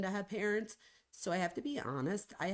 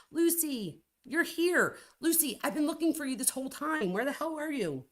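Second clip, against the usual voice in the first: much higher and louder delighted greeting; excited calling out; 9 semitones higher, 6 dB louder than usual. Real, not acted. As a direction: call out happily to a friend who just arrived. Friend shows up in chat.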